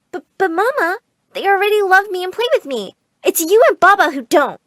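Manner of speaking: put-on high, stammering child's objection; hurt, high-pitched protest; pleading protest. A narrator's reading. Child pleading with scolding mother.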